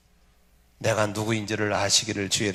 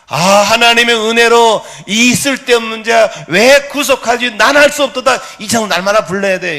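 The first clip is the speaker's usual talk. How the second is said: high, loud hymn recitation; fervent preaching; 9 semitones higher, 10 dB louder than usual. Real, not acted. Preacher declaiming a hymn of grace to congregation, fervent. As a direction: cry out the hymn words fervently.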